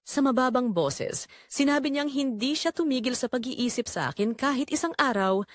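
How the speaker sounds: ordinary delivery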